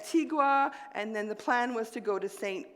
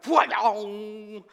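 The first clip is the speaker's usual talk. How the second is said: sudden loud jolt sound; zapping noise; 5 dB louder than usual. Retold or imitated. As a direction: blurt the jolt of electricity. Electric bolt through body.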